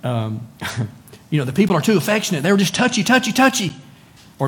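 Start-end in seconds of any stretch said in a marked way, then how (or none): 1.5-3.7 higher, louder whiny complaining voice; hammed-up gripe, touchy rising high; mock complainer. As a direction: whine it like a fussy churchgoer. Mimicking complaining churchgoer.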